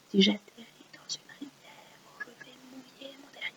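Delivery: barely audible whisper; whispered echo. Shown as a demonstration. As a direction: whisper the verse back very faintly. Echoing the line for pupils.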